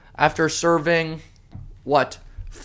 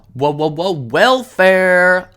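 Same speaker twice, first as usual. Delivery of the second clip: louder sarcastic build to welfare; mock dramatic reveal; 4 dB louder, slower than usual. Ranter revealing the family's welfare to viewers, sarcastic. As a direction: hold back, then hit welfare.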